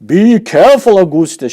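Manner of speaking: high, loud warning; a father shouting a warning to his son. Acted. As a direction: warn him at full voice. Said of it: "shouted Mister Gloop".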